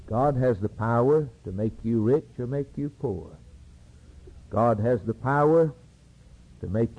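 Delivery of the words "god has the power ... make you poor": ordinary talk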